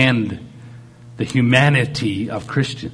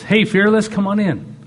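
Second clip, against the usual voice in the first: welcoming call; 9 semitones higher, 3 dB louder than usual. Acted. God greeting the weary believer.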